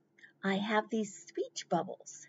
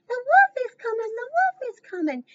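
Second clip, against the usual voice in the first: much higher, fast alarmed cry; panicked pig; 7 dB louder, faster than usual. Acted. Farmer pig warning his brother, much higher and fast. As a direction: run in shouting the warning. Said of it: "he said".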